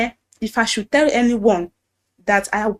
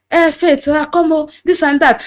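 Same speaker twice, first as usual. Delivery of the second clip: pitched higher, coaxing pastor on the phone; imitating the pastor; 6 semitones higher, 4 dB louder than usual. Pastor phoning Faith to summon her, higher coaxing voice. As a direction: call her over like the pushy pastor.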